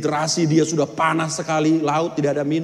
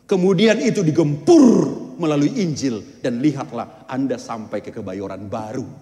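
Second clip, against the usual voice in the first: ordinary delivery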